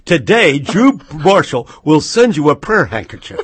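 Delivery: loud, hammed-up preacher pitch; mock televangelist. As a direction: parody a TV evangelist begging for gifts. Parodies televangelist selling prayer handkerchiefs.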